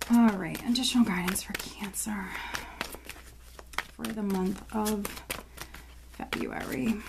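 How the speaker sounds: soft whisper fading away. Real, not acted. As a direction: breathe it out under your breath. Whispers heading to herself.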